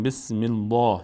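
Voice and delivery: "بسم الله" with dark, full L and back "aa"; wrongly heavy L. Shown as a demonstration. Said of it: "نمیگیم". («بسم الله» با لام پر و درشت)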